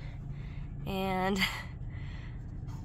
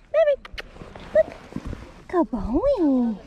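squeaky praising a dog; 6 semitones higher, 6 dB louder than usual. Real, not acted. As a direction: gush over the dog in a squeaky voice.

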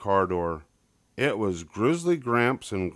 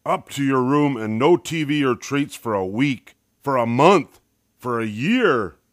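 louder, building furious father; each punishment bigger and louder; angry roar; 4 semitones higher, 6 dB louder than usual. A narrator's reading. Papa piling on punishments, louder and louder. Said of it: "roared Papa".

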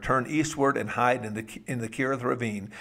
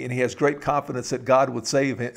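ordinary talk, nothing marked

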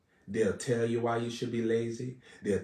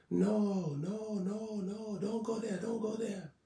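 higher, softer pleading warning, not a real shout; an urgent inner voice saying no; a hint of a distant divine call; voice of the Lord warning; 9 semitones higher, 7 dB quieter than usual. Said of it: "the Lord shouting at you".

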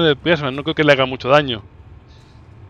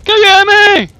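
high, loud panicked shout; alarmed yell; 10 dB louder, much slower than usual. Pretend. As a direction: shriek the warning as it charges. Enemy approaching, alarmed yelling. (grito agudo de pánico fingido)

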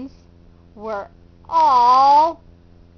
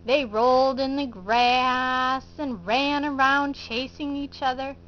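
plain reading voice

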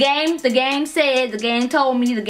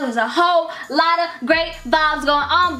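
ordinary delivery

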